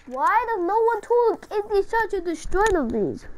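usual speaking voice

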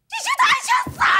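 high-pitched anguished cry; shrieked warning; distraught shout. Acted. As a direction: cry it out in desperation. Mother threatens during quarrel.

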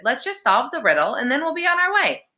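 high, brisk sisterly urging; high-pitched practical plea; insistent coaxing. A narrator's reading. Alex urges her brother on.

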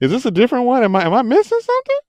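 high, loud, hurried confusion; mock bewilderment. Viewer puzzled by the new Lupin, high mock bewilderment. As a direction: play up being totally thrown. Acting out his reaction to show.

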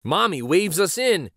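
ordinary delivery, nothing marked

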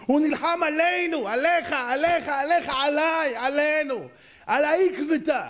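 stretched-out fervent preaching; impassioned preaching. Real, not acted. Preaches with full force on Jacob.

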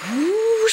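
high, loud gust of whoosh. Acted. Breeze through Minister Whitehall's windows, a high whoosh. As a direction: blow it like a gust. Wind sound effect.

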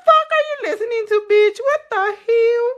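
high-pitched imitation of a husband; mock outraged husband. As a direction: act out his outrage, high and fast. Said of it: "He'd be like".

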